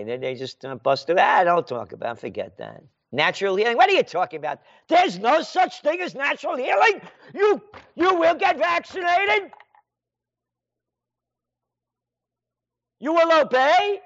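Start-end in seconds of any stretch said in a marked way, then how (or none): 3.1-14.0 higher, shouted orders; mock official shouting. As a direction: bark it like a tyrant.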